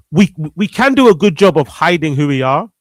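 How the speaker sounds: ordinary talk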